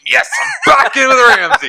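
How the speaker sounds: high, gleeful exclamation; excited cheer. Retold or imitated. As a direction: act out a giddy, high-pitched cheer. Acted excited reaction to watching.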